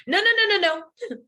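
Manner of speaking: emphatic no. Retold or imitated. Giacomo across the table stopping her from leaving, loud insistent protest. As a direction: cut in hard to stop her leaving.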